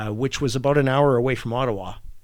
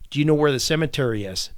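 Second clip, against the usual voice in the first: normal talking voice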